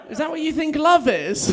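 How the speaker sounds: high, loud mock indignation; indignant retort. Comedian retorts to the guy about love, indignantly.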